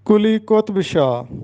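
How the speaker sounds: کلی قطب شاہ with a clear u in both words; correct pronunciation. Shown as a demonstration. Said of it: "یہ درست تلفظ ہے". (درست تلفظ: کلی قطب شاہ)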